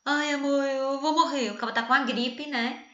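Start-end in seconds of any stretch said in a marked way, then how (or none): 0.0-1.5 mock dying husband, louder and higher; lightly hammed-up moaning to his love; whiny sick man. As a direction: ham up a man with the flu begging his wife. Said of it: "o homem tá doente".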